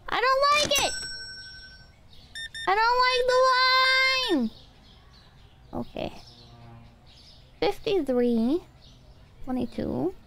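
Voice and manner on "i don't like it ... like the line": high whiny complaint. Real, not acted. Panicking over long checkout line.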